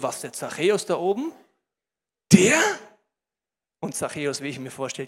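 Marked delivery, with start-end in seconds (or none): 0.0-2.6 acted disbelieving question, rising at the end; mock astonishment; incredulous onlookers. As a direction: question it as a baffled crowd would. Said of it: "und denken sich".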